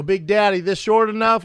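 high, loud imitation of workers calling to the boss. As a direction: holler up like a crew checking in.

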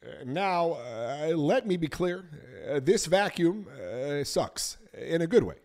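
halting, measured politician voice; polite, with careful pauses; Obama impression. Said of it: "Barack Obama, what would he do".

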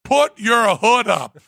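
mock yell, slow; retold shout. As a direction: call it up to the limo roof. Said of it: "to scream and gesture simultaneously".

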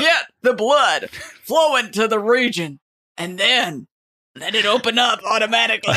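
laughing coach voice, fast end; football coach. Pretend. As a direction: coach them through it, laughing. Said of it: "the football coach was asked to teach".

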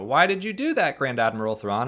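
high, loud put-on wonder; imitating an eager Watson, voice jumping up; mock sidekick question. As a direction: ask it like an awed sidekick, hammed up. Acts out the admiring sidekick.

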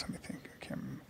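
barely audible mock thinking aloud; imitated stalling whisper. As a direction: whisper it like a faker fumbling for an answer. Acting out the faker stalling.

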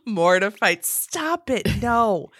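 normal talking voice

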